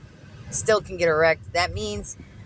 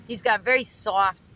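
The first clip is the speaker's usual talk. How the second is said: normal talking voice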